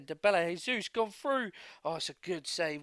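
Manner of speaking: urgent call as the striker breaks through; excited commentary. Real, not acted. Calls a breakaway chance on goal.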